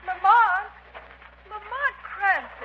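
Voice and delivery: loud call across the beach, name drawn out. Acted. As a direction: call his name from far away. Calling out on the beach.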